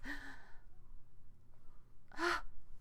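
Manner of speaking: soft breathy gasp of delight; lightly voiced surprised gasp; excited gasp. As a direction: gasp as if spotting the perfect volunteers. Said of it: "her face grows wide".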